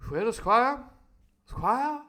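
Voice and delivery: loud high plummy call; posh doctor. Acted. Doctor Livesey addressing the Squire, loud and plummy. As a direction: get his attention, grandly.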